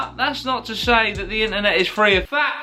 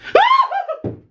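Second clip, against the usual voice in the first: high mock laugh; 3 dB louder than usual. Mock laugh after imitation.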